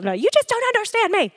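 high, quick mock teen whine; put-on adolescent protest; upset teenager. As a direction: imitate a misunderstood teenager storming off. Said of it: "had a teenager that has said something".

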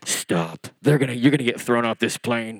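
low, warning inner voice; acted doubting thought; voice of inner fear. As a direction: drop deep to warn yourself off. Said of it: "The first army's telling me".

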